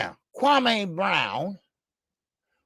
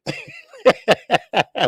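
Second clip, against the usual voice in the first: high laugh; 6 dB louder than usual. Real, not acted. Laughs at own rant.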